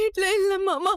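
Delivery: tearful, sobbing reply; crying. Acted. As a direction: answer through sobs. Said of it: "முதல்ல அழுகுற நிறுத்து". (அழுகையோடு பதில்)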